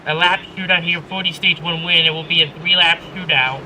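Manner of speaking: ordinary talk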